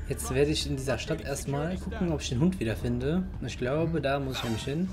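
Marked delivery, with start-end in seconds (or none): none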